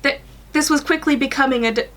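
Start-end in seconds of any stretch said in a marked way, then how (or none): none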